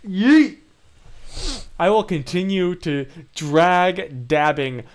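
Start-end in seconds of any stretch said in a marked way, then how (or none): 0.1-0.6 playful yell. Pretend. Shouting the meme word.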